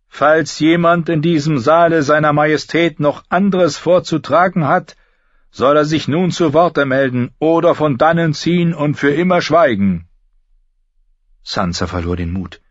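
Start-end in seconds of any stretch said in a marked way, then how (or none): 0.1-10.1 high, loud royal announcement; loud herald. A narrator's reading. Herald calls the court to speak now, loudly. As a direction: call it across the hall. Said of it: "Des Herolds Stimme wurde laut".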